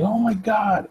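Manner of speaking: high-pitched shocked "Oh my God"; shocked cry. Retold or imitated. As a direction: gasp it out, pitch jumping way up. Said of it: "the lady's like".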